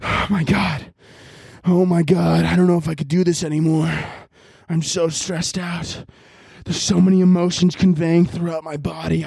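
breathy, stressed-out melodrama; overacted method acting. Shown as a demonstration. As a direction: gasp it out as if overwhelmed by emotion. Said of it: "you gotta live the role".

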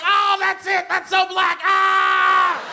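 high, loud ecstatic cries; ecstatic shout. Retold or imitated. Comic recalling watching eulogy, ecstatic high shouting. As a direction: shout like the spirit hit you.

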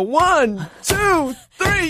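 high, rising count from heaven; a playful heavenly countdown; ancestors counting. Retold or imitated. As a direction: cheer her on like the ancestors above. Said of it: "going like".

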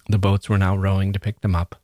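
ordinary delivery, nothing marked

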